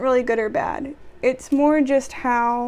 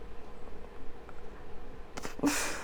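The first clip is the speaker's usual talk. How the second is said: long exasperated exhale; exasperated sigh; 15 dB quieter than usual. Real, not acted. Worker fed up with a customer's complaint, exasperated sigh. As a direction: sigh at length before answering back.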